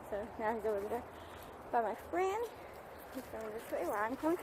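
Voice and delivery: ordinary talk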